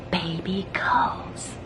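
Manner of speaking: slow, ominous whisper. A narrator's reading. Violet scares Rascal about a baby-stealing robber, in a whisper. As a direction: drop to a drawn-out whisper.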